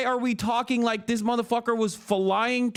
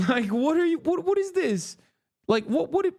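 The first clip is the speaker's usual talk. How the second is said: higher, sputtering what-what; exasperated disbelief; 10 semitones higher than usual. Real, not acted. Streamer fuming at the briefing clip, sputtering high. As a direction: splutter in outraged disbelief.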